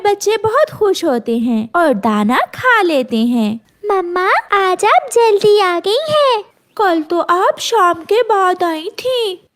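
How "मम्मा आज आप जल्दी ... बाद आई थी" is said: put-on higher, childlike chick voice, eager; a little bird child happily greeting mama; chick's voice. Kids voiced in story. (चूज़े की ऊँची, खुश बच्चों जैसी आवाज़)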